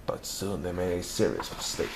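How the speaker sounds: plain reading voice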